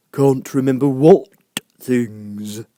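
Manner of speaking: a probing drawl; caterpillar. A narrator's reading. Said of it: "said the caterpillar".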